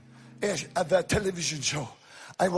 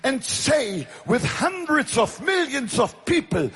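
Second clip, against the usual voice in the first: loud, raised-pitch sermon shout; full-force preaching; 8 semitones higher, 6 dB louder than usual. Real, not acted. Evangelist rousing a crowd about the blood, full-force preaching. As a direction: preach it at the top of your voice.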